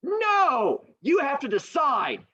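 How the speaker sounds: high squeaky crowd whine; townspeople voice. Retold or imitated. Townspeople refusing to choose, high squeaky whine. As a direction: pass the buck in a high silly voice. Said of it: "the people all look at each other and go".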